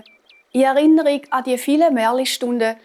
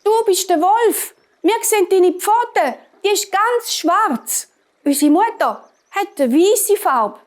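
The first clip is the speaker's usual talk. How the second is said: put-on high, indignant little kids; high-voiced kids shouting through the door; goat children calling out; 8 semitones higher, 4 dB louder than usual. A narrator's reading. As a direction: pitch it way up and accuse the wolf. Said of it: "haben sie gerufen".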